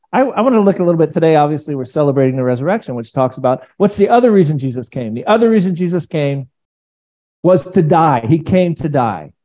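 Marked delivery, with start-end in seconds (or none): none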